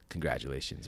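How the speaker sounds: low, soft, deadpan congratulations; stern officer. Retold or imitated. Customs officer handing phone back, low stern deadpan. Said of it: "Still super stern".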